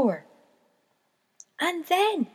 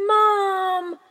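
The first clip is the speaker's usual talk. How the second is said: high loud call for mum; crying out; 6 semitones higher, 8 dB louder than usual. A narrator's reading. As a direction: call out desperately. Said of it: "he cried".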